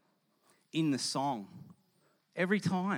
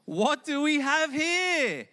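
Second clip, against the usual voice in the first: smug do-gooder; 4 semitones higher, 4 dB louder than usual. Retold or imitated. Preacher mocking a smug giver to the congregation, high and self-satisfied. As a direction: lay it on thick as a smug show-off. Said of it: "It's like".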